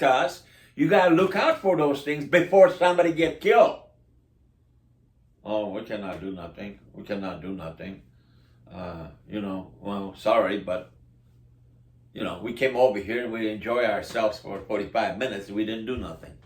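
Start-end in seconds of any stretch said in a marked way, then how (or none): 5.4-16.3 low, soft, lazy mock of the police excuse. Neighbor mocks police who did nothing, low mock drone. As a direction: drone it out like a bored cop brushing you off.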